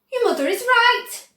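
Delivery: high, loud alarmed shout. A narrator's reading. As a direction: bellow in alarm at the daughter. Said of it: "shouted Mister Beauregard".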